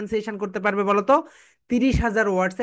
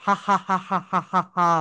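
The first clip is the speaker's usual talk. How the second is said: rhythmic spoken ha ha ha laugh; demonstrated laugh; 3 semitones lower than usual. Shown as a demonstration. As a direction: show the class a laugh. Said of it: "I can laugh". (ক্লাসকে হাসি দেখানো)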